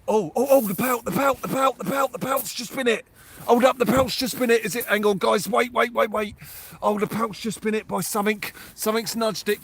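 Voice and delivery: high, loud, rapid bursts of thrill as the bait gets hit; can't contain it, voice jumping up and loud; real excitement. Real, not acted. Angler on a livestream seeing his rod bitten, genuinely excited. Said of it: "Sorry, I get excited".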